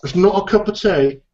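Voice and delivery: a hammed-up English correction; half-hearted English accent. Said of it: "do an English accent".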